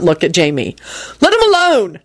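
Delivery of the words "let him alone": high, sharp bark; barked order. A narrator's reading. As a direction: bark it out in a raised pitch. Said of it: "Jamie barked out".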